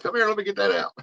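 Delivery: high, excited, rushed fatherly calling; dad calling his hooked daughter over; flustered dad. Retold or imitated. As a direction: hurry her over, half panicked. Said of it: "I was like".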